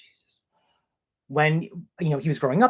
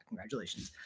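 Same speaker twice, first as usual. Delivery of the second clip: ordinary talk, nothing marked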